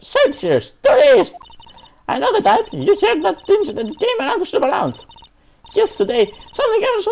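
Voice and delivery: put-on shrill high old woman, very high; bossy old security lady yelling. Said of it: "Stop the old lady voice".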